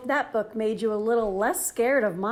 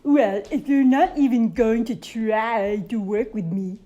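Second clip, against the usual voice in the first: louder, complaining monster whine; whiny monster; 7 dB louder than usual. Acted. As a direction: sulk like a rejected monster. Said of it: "Mac whined".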